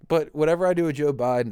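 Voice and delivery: ordinary delivery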